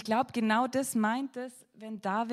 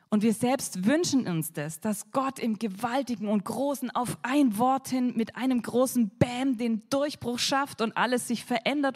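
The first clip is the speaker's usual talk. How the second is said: usual speaking voice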